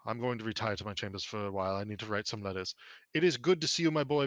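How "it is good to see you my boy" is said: louder, higher glad welcome to his son; a father happy to see his son; warm greeting. Acted. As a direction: greet your boy with real gladness.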